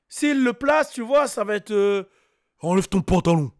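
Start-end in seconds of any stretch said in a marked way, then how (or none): 2.6-3.5 deep, heavy menacing order; aggressive rapper voice. Acted. Imitating the rapper ordering a girl, heavy and aggressive. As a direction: imitate a big threatening voice. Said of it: "ça fait plus agression".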